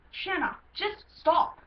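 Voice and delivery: put-on halting, insistent plea in a British accent; Luke. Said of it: "Luke I'm gonna do in a British accent".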